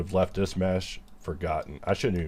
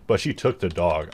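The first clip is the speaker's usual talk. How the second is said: loud mock lament; 4 semitones higher, 7 dB louder than usual.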